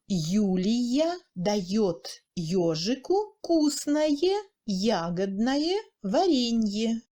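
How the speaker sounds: very slow, word by word; slow demonstration. Shown as a demonstration. Teacher repeating example for learners, slow and word by word. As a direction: separate each word of the sentence, drawn out.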